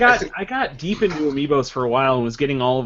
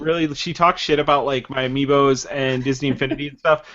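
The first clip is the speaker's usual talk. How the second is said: ordinary talk, nothing marked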